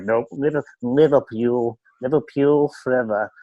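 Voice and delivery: badly faked Liverpool accent; horrible English accent. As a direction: have a bad go at a proud Liverpool accent. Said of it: "horrible English accent".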